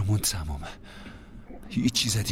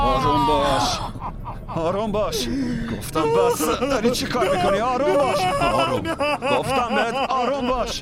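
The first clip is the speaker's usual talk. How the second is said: much higher and louder, urgent commands; shouting; 6 dB louder than usual. Acted. Man shouting at a struggling boy to calm down. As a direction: yell to stop someone struggling.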